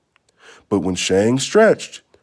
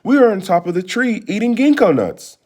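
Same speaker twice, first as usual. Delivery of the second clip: calling out; 10 semitones higher than usual. A narrator's reading. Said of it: "Shang called out".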